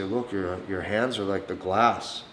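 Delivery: gently compare her hands; dumbed down talk. Shown as a demonstration. Holding her hand at the bar, soft and simple. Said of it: "very dumbed down talk".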